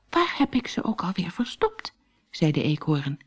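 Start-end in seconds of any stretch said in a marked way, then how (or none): 0.1-1.9 hushed forgetful muttering; whispered to himself. Acted. Squirrel wonders aloud where he hid his nuts, whispered. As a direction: whisper it, searching your memory.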